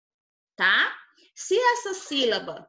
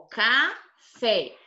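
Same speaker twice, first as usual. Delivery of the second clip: café very slowly, ca... FÉ; slow classroom demo of ca-FÉ; stressed last syllable. Shown as a demonstration. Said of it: "O fé é a sílaba mais forte".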